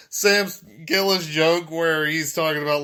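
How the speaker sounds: ordinary talk, nothing marked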